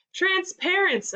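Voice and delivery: high, drawn-out punchline; announcing. A narrator's reading. Ethan delivering his joke's punchline, announced high and slow. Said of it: "announced Ethan".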